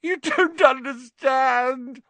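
high, drawn-out whine; high-pitched, self-pitying wail; whining. A narrator's reading. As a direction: whine it slowly and desperately. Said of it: "whined Pettigrew".

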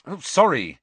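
exasperated sorry; impatient. A narrator's reading. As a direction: apologise with impatience. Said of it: "he said impatiently".

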